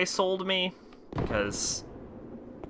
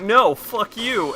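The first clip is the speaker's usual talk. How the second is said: retold defiant Dante; 8 semitones higher, 4 dB louder, much slower than usual. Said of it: "Dante's like".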